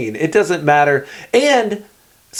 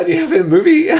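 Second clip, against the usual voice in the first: much higher, laughing question; jokey mock question; 12 semitones higher, faster than usual. Imitating John dodging reading by asking for a movie, laughing. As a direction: squeal it through a laugh, mock-eager. Mocking the non-reader's eager question.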